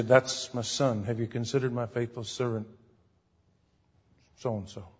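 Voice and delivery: a hint of a low divine voice; voice of God. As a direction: speak as God boasting of a servant. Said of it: "God can say".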